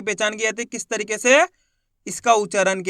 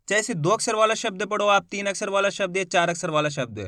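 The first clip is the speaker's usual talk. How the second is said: usual speaking voice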